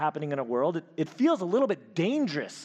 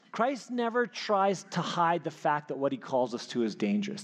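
ordinary talk

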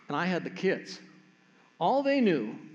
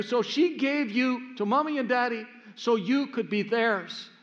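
put-on higher, earnest child voice patiently explaining; big sister explaining; 5 semitones higher than usual. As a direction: lay it out for little brother like a know-it-all sister. Sister explains adoption to brother.

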